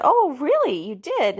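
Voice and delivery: high, lilting retold surprise; humoring surprise. Mother humoring her daughter's imagination, playfully surprised. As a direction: act intrigued, high then dropping.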